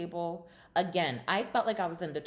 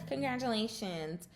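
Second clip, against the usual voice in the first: mock sneer; 7 semitones higher than usual. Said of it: "here she goes".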